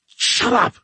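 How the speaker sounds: high sharp yell; shouting. Acted. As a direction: snap it out high. Giles snaps at Dora.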